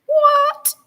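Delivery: squeaky high startled what; high-pitched outraged what; shocked what. Real, not acted. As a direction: jump up in disbelief. Reacting to snow in April.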